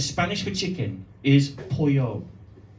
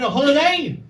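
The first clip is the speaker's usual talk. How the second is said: rising, louder build to a shouted reveal; building excitement; 7 semitones higher, 4 dB louder, faster than usual. Real, not acted. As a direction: pump the crowd up to the punchline.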